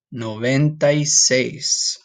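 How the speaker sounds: Sesenta y siete at a slow, deliberate pace; slow Spanish. Shown as a demonstration. Teacher slowly modelling the number for students. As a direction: drag each word out for learners. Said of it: "would be noventa".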